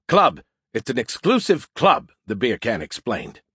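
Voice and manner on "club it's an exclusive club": "Club" punched high and louder, then falling; insistent high emphasis on "club"; corrective stress. Acted. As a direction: correct them firmly, hitting "club". Skippy corrects cult to club.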